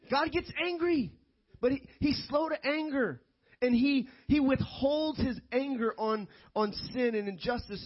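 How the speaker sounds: high-pitched, insistent pulpit delivery; emphatic preaching. Real, not acted. Preacher teaches congregation about anger, emphatic high-pitched preaching.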